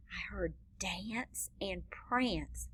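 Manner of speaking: put-on breathy stage whisper to the kids. Shown as a demonstration. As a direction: whisper the rhyme like a secret. Teacher whispers rhyme aside.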